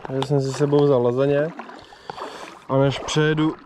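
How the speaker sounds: usual speaking voice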